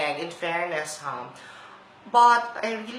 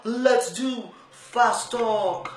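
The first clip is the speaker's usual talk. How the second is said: slow, drawn-out ad voice over music; ad read; 2 semitones higher, 4 dB louder, slower than usual. Acted. Announcer pitching the app to drivers, slow ad read. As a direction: sell it like a radio spot.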